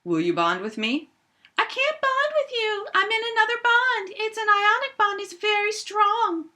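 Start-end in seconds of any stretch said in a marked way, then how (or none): 1.6-6.4 very high, prim little bear turning a suitor down; tiny cartoon bear, very high-pitched. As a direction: squeak out a polite but firm no. Bear refusing another bear's offer.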